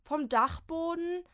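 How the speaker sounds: high, loud, slow whine; whining. Acted. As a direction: wail at the thought of the attic. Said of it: "jammert Molly". (laut jammernd)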